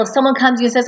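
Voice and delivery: ordinary delivery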